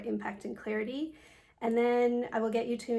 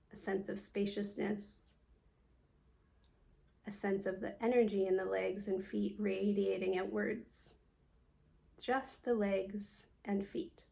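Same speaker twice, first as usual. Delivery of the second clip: guided meditation. Acted. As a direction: guide them calmly through a body scan, leaving space after each phrase. Leads a yoga nidra meditation.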